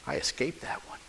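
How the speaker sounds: whispered, relieved dog talking to himself. Acted. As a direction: breathe it out in a hushed whisper, safe at last. Dog relieved, lion ran off.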